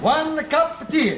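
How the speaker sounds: slow, sing-song put-on Italian accent. Milkman serving Fletcher tea in a put-on Italian accent. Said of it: "He used to talk broad Cockney at school".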